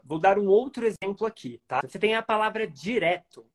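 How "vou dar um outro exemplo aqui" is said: ordinary talk, nothing marked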